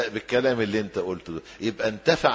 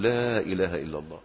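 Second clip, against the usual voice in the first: softly and a little lower, coaxing the dying man; gentle soft prompting; 5 semitones lower, 5 dB quieter than usual. Shown as a demonstration. Preacher shows how to prompt a dying man, gently and softly. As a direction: murmur it gently at the bedside. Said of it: "نقعد جنبه كده نقول".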